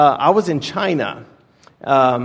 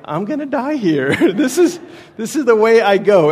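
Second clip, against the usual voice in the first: laughing mock dread; 6 semitones higher, 4 dB louder than usual. Retold fear in knife fight.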